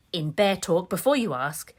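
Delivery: ordinary delivery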